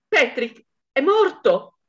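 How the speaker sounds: the wife cries to the police that her husband is dead; anguished cry. Acted. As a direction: wail "Patrick è morto!" stretching "morto" with a fall. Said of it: "gridò".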